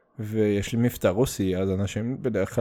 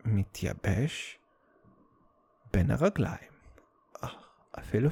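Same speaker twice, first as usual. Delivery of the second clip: whispered; 3 semitones lower, 8 dB quieter than usual. Pretend. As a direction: drop to a whisper for effect. Dramatic punchline, slowed, whispered.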